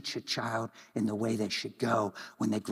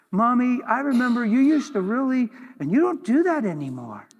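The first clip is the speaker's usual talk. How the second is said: put-on child's voice; 9 semitones higher, 7 dB louder than usual. Child recalling mother's change.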